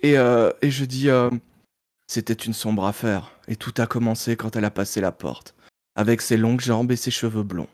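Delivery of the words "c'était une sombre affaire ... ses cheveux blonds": low, hushed film-noir detective narration; voix sombre de détective. Acted. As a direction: set the dark scene, deep and subdued. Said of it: "si tu prends une voix sombre". (voix grave et sombre de polar)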